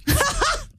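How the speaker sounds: high burst of laughing; high-pitched helpless laugh; laughter. Real, not acted. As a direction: crack up at your own story. Laughs at own anal beads story.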